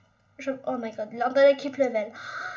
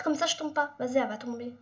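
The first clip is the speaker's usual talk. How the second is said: usual speaking voice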